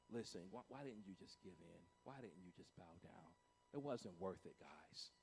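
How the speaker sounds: very low, very soft lament; hushed regret. Acted. Onlooker to the men thrown into the furnace, hushed and low. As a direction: drop to a murmur, as if all is lost. Imagined onlooker mourning the men's fate.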